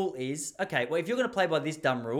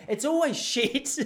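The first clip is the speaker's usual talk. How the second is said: slow laughing punchline; rueful laughing aside, slowed; 10 semitones higher, 4 dB louder, slower than usual. Real, not acted. Laughing at own bad dates.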